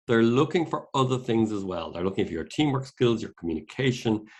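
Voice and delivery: normal talking voice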